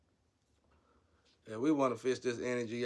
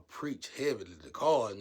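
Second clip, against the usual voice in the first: ordinary talk, nothing marked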